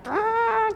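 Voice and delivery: high held "aah" like a car horn; bocinazo imitado. Retold or imitated. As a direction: imitate an impatient driver's horn. Imita la bocina del carro. (bocinazo imitado con la voz)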